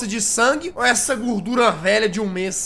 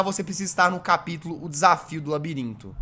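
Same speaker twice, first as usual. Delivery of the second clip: ordinary delivery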